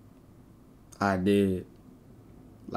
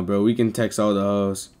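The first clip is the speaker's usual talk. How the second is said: usual speaking voice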